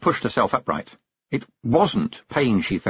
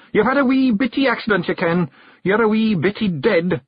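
high, lively little Scottish man breaking news; Rob Anybody, blunt Scottish Feegle; 11 semitones higher, 3 dB louder than usual. Acted. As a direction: pipe up as a tiny blunt Scot. Narrator plays Rob Anybody.

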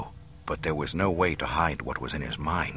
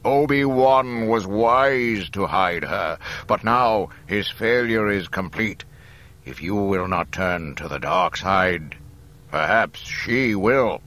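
loud, slow, triumphant villain; gloat, then threaten with slow force; Vader, gloating threat; 3 semitones higher, 7 dB louder than usual. A narrator's reading.

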